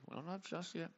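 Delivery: very soft, under the voice; hushed afterthought; quiet repeat. Real, not acted. Same words repeated far quieter.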